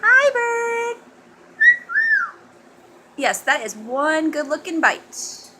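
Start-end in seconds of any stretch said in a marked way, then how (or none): 0.0-1.0 high, loud sing-song greeting; teaching a parrot. Shown as a demonstration. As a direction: sing it up high for the bird to copy.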